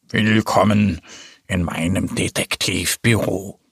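a kid playing a serious detective, deep. A narrator's reading. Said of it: "sagt mit tiefer Stimme".

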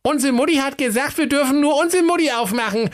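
put-on very high, squeaky kid voice; high-pitched, bratty fairy-tale goatlings; innocent little goats. A narrator's reading. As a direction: play cheeky little kids acting innocent. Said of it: "taten die Geißlein recht unschuldig".

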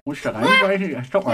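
Süßigkeit im Mund, undeutlich sprechend; mit vollem Mund. Real, not acted. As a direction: mit dem Bonbon im Mund reden. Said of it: "ich krieg als erstes eigentlich den Mund gestopft". (mit vollem Mund)

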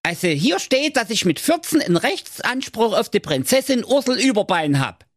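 put-on high cheeky young lad; Schnitzelschorsch voice. A narrator's reading. Narrator voicing Schnitzelschorsch, a cheeky high voice.

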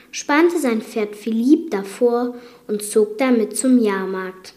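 ordinary delivery

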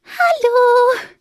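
a shy little mouse squeaks hello; soft squeak. A narrator's reading. Fipper greets the magpie shyly, high squeaky hello. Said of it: "fiepste ein sanftes".

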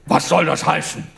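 hammed-up thundering knight. A narrator's reading. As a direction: boom the question like an angry knight. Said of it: "donnerte Romuald".

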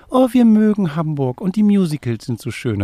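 high, excited gushing fan voice; gushing tourist. Retold or imitated. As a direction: imitate a delighted camper, chirpy and high. Campingnachbar zitiert, hohe Stimme. (Camper schwärmt hoch und begeistert von Hamburg)

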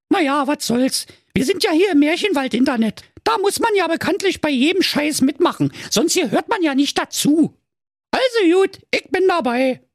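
very high, squeaky Berlin hedgehog; Inge going along. Acted. She-hedgehog joining the prank, squeakily game. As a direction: agree in a piping high voice.

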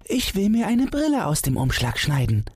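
put-on high-pitched little boy's voice; five-year-old son. A narrator's reading. Son asks father for scissors, high child's voice. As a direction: pitch it way up like a small child asking.

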